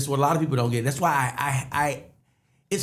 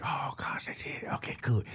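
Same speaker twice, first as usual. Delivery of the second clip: soft whisper to himself; whispered relief; 11 dB quieter than usual. Retold or imitated. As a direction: breathe it out like a secret relief. Relieved self-talk after first laugh.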